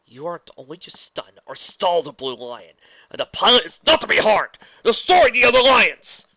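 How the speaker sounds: building to a loud shout; furious commands. Acted. Prince shouting orders in rage.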